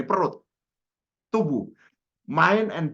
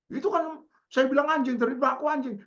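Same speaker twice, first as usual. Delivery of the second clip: high, aggrieved insistence; pitched-up imitation of an angry listener; mock indignant protest; 11 semitones higher than usual. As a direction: argue back like the insulted one. Offended man argues back.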